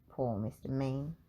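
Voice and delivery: ordinary delivery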